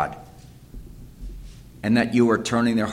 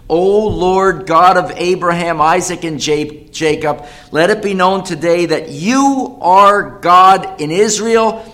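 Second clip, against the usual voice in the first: fervent preached prayer; 7 semitones higher, 8 dB louder than usual. Acted. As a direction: cry out to God so all can hear. Elijah's prayer, preached aloud.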